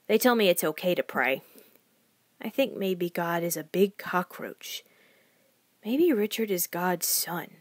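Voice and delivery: ordinary delivery, nothing marked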